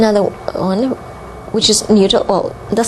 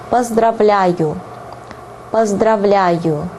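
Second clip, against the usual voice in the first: slow Russian; slower than usual. Shown as a demonstration. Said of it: "slow teaching repeats".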